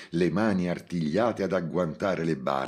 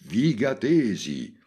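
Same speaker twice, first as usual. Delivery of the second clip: high, loud, drawn-out call; shouted call again; 6 semitones higher, 4 dB louder, slower than usual. Acted. Montalbano repeats his call to the crowd over the speakers. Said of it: "ripeté".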